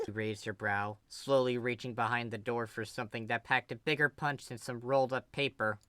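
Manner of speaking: usual speaking voice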